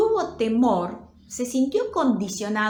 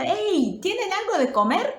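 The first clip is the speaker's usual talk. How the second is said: high shout across the water; calling out; 10 semitones higher, 4 dB louder than usual. Acted. Jesus hailing fishermen from the beach, calling out. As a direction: call out as if to people far away. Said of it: "desde la playa le grita".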